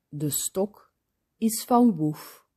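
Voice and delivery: plain reading voice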